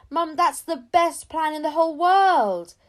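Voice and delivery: loud, high, thrilled cry; delighted. Acted. Child thrilled by mum's plan, excited shout. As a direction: burst out with joy.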